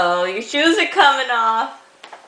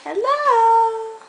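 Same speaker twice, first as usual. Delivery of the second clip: high sing-song hello; high baby-talk hello; 8 semitones higher than usual. Acted. Greeting for the baby.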